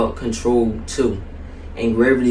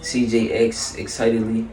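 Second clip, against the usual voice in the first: ordinary delivery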